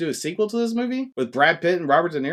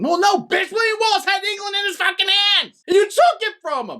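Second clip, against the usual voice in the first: high, shouted retold tirade; mock outrage; 14 semitones higher, 3 dB louder than usual. Retelling the betrayal of Wallace, high mock-furious shouting. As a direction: yell it like an outraged film hero.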